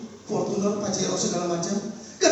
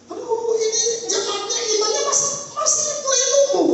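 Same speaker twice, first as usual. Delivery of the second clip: much higher, pleading lament; 10 semitones higher than usual. Retold or imitated. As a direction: complain to God in distress. Pastor lamenting to God. (gembala mengeluh kepada Tuhan, nada sangat tinggi)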